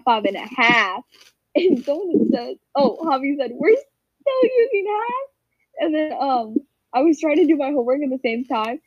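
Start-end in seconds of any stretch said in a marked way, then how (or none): 3.5-5.3 high-pitched, drawn-out disbelieving question; mock incredulity. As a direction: quote it in teasing disbelief. Retelling a friend's incredulous jab.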